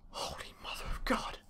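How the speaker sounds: hushed whisper in dread. Real, not acted. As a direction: breathe it out in fear. Creepy dark dungeon, sudden whisper.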